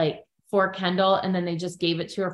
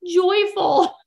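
high, bubbly praise; gushing Chloe; 11 semitones higher than usual. Retold or imitated. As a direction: gush over her like a sweet teammate. Chloe encouraging Nia.

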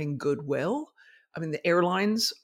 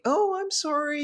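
mock apologetic clerk; 11 semitones higher than usual. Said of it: "rather than saying".

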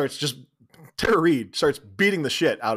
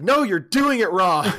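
bossy correction; 6 semitones higher, 5 dB louder, slower than usual. Acted. Posey correcting the subliminal track.

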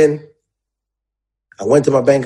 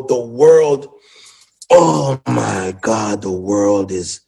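choked up; 5 semitones lower, much slower than usual. Real, not acted. Overwhelmed by sudden success.

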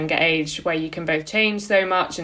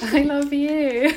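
mock sappy; 9 semitones higher, much slower than usual. Friend returns a sappy declaration.

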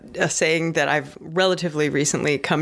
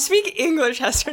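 mock demand; 8 semitones higher than usual. Teasing host demands plain English.

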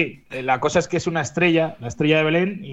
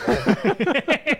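laughing; 8 semitones higher than usual. Real, not acted. Joking about the photo.

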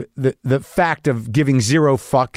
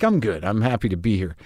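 George Clooney's cool reply; 5 semitones lower, 5 dB quieter than usual. Retold or imitated. The star greeting a podcaster at the screening, low and hushed. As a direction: lower and softer, laid-back movie-star cool.

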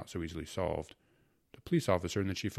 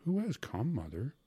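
higher, urgent question; anxious child asking; 7 semitones higher than usual. A narrator's reading. Boy asks his pale mother who has come, anxiously. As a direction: ask it pitched up on the first words.

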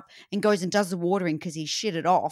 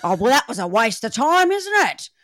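higher, louder mock complaint; acted griping; 5 semitones higher, 5 dB louder than usual. Gardener complains that it rained after watering, griping. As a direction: grumble theatrically over wasted effort.

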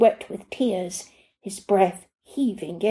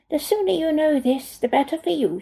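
lightly voiced foreign nanny; 7 semitones higher than usual. A narrator's reading.

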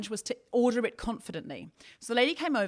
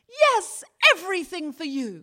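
much higher and louder, beaming with joy; delighted; 7 semitones higher, 7 dB louder than usual. Retold or imitated. Waitress delighted by the confident cake order, beaming and high. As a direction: gush it like she's thrilled for you. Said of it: "she lit up... she patted me on the arm and she went".

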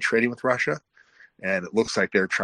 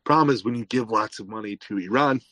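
ordinary talk

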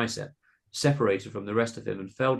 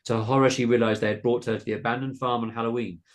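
usual speaking voice

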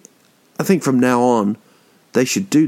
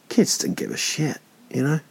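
dropped much softer, almost whispered; hushed aside; 4 semitones higher, 6 dB quieter than usual. Real, not acted. Telling listeners about the kids skipping the tree, hushed aside. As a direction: let it slip out under your breath.

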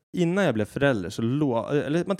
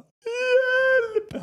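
put-on very high, loud kid's cry; child crying for help; 6 dB louder than usual. Son locked outside the door calling, high wail. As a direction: wail it like a small boy shut outside. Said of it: "han bara".

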